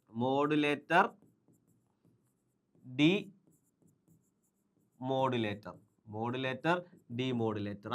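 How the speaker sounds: word by word with long gaps; dictating to oneself at the board; writing-out pace. Real, not acted. Saying words while writing them out.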